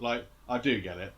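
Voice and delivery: usual speaking voice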